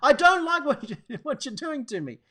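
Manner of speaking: put-on high, loud angry child's protest. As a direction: shout it like a furious little boy. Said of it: "then you get angry".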